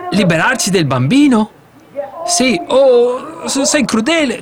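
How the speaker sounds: high, shocked wife's voice; a mother recoiling in horror; distressed wife. Acted. Jochebed shocked at her husband, high pitched. Wife protests giving up baby.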